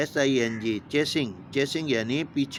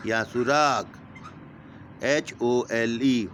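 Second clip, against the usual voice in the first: ordinary talk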